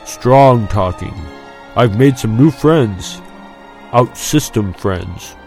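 slow, deliberate disclosure; weighty hinting. A narrator's reading. Friend hinting to Luke about secret allies, weighty. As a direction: let each phrase land with significance.

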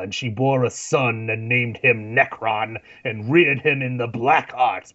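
slow, grand movie trailer narration; epic fantasy narrator. Retold or imitated. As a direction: intone it like an old legend. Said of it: "They say".

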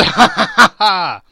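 high, loud, hearty laughter; uproarious acted guffaw; splendid laugh. A narrator's reading. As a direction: laugh long at full voice. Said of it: "it was a splendid laugh".

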